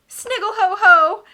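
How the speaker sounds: high, loud, slow shrieking mock laugh; shrieked laugh. A narrator's reading. Esme celebrating with Olaf, shrieking mock laughter. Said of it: "Esme shrieked".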